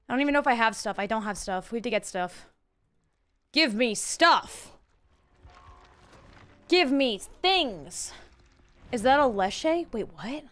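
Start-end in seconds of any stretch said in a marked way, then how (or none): 3.5-8.1 slow mock begging. Begging the game for items.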